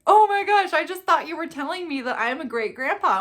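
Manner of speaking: high, loud amazed exclamation; grandpa's realization. Retold or imitated. Grandpa realizing the card's meaning, amazed. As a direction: gasp it out, amazed. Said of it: "he was like".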